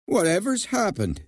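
high-pitched startled question; alarmed. A narrator's reading. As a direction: ask with sudden concern. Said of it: "looked up in alarm".

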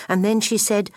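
usual speaking voice

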